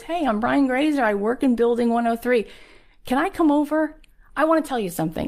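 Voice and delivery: high-pitched, eager pitch on the phone; excited. Retold or imitated. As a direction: act out pleading to be let in. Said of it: "but you're so excited".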